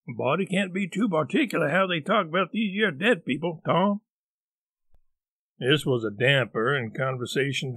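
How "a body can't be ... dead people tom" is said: lightly voiced country boy; drawling backwoods boy, reproachful; Huck's voice. Huck character voice.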